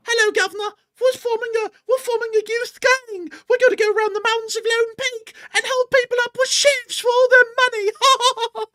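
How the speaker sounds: very high, loud squeaky pup voice; youth gang marmot. Acted. Marmot pup announcing a youth gang, very high British squeak. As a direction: pipe up as a tiny British gangster pup. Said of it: "I respect the youth gang marmots".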